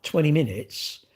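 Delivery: ordinary talk, nothing marked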